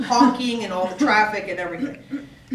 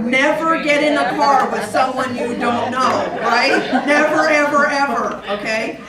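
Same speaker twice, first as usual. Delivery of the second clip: loud, high, hammering never ever; mock-stern parent lecture; emphatic warning; 4 semitones higher, 8 dB louder than usual. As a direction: drill the rule into a kid. Mimicking the stranger-danger rule.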